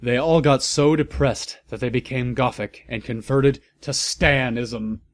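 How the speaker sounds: ordinary delivery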